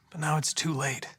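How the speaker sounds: normal talking voice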